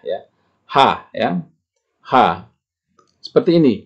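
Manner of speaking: ordinary talk, nothing marked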